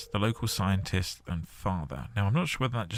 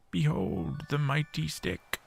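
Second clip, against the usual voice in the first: slow, higher mock-epic announcement; mock grandeur; 7 semitones higher, slower than usual. Real, not acted. Gamer presenting his stick, slow mock-heroic proclamation. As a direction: unveil it like a legendary weapon.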